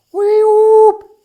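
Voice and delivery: very high, loud elephant trumpeting call. Acted. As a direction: blast it out like an elephant calling its herd. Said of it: "trumpeted Alice".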